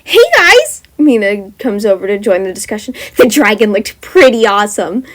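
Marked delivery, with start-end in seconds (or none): 0.0-0.8 very high, loud excited call; loud greeting. A narrator's reading. Mina joining classmates to talk dragon, loud excited call. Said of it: "Mina was rather loud". 3.2-5.0 louder, enthusiastic declaration. A narrator's reading. Mina declaring to the class, loud enthusiastic voice. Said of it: "Mina was rather loud with her declaration".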